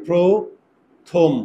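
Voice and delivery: প্রথম drawn out very slowly as proth-om; teacher slowing প্রথম right down for pupils; slow spelled-out word. Shown as a demonstration. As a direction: stretch প্রথম to show the o sound. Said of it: "এই যে ওকার".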